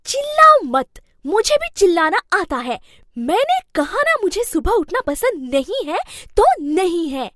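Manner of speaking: loud, high yelling back; angry shouting. Acted. Bahu yells back at her husband, furious shout. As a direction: shout him down, I can yell too.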